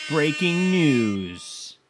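very slow, booming bulletin voice; dramatic breaking news read; big news announcer. Shown as a demonstration. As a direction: boom it out like a TV news anchor. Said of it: "Need a air horn sound effect".

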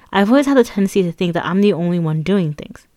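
plain reading voice

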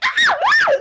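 very high, loud short screams; screaming. Acted. Two girls screaming at each other over a book, screaming. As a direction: shrieks of two fighting sisters. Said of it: "They're screaming at each other".

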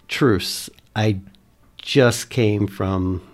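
loud, slow, measured hail; non-alarming but audible. Acted. Player hailing the group from a distance, loud measured call. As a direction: project each word without sounding a threat. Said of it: "but one that can be heard".

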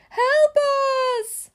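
high, loud cry for help; storybook distress call. A narrator's reading. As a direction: call out as if trapped. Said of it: "Missus Watson called".

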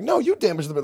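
high-pitched mock indignation; imitating an outraged denial; retold indignant protest. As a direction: throw the blame back, pitch jumping up. Retold protest at false accusation.

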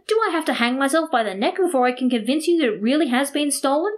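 high, desperate pleading; acted despairing complaint; exasperated protest. A narrator's reading. Victim desperately insists he's been robbed.

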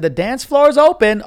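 retold announcement. Retelling the wedding's dance floor opening, excited loud call. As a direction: call it out to the whole room.

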